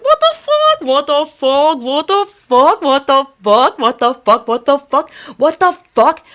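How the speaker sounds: high, loud repeated outraged disbelief; shocked disbelief. Retold or imitated. Ranting to viewers about the ban, shocked outraged disbelief.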